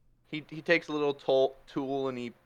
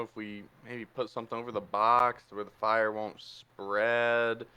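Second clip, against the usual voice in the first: lower, quieter, slow musing; weary Zenith; 4 semitones lower, 4 dB quieter, slower than usual. Acted. Zenith weighing the box to the party, low weary musing. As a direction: weigh the grim options reluctantly. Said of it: "He's like".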